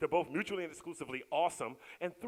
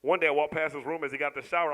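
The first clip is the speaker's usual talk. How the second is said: ordinary talk, nothing marked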